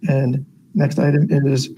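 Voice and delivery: normal talking voice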